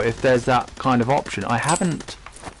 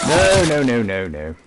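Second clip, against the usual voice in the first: dismayed no. Real, not acted. Gaming mishap, sudden dismay.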